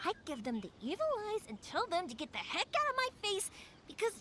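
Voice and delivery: ordinary talk, nothing marked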